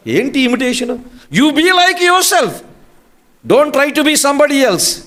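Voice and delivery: high, insistent preacher's voice; high-pitched pulpit challenge; fervent exhortation. Real, not acted. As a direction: exhort the congregation with pitch pushed up. Preacher exhorts congregation against imitating.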